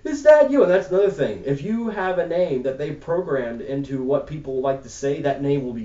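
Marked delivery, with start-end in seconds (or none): 0.0-0.6 polite butler robot. Retold or imitated. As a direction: greet him like a delighted servant robot. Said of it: "And he's like".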